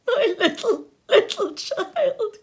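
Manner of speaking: put-on grieving father crying over his lost child. A narrator's reading. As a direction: voice breaking into a high sob. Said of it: "cried Bob".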